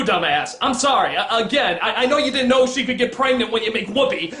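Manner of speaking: ordinary talk, nothing marked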